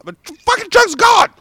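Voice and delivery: high panicked shout; shocked yell. Retold or imitated. Fan finding his truck gone, yelling in shock. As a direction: cry out in disbelief at the empty spot.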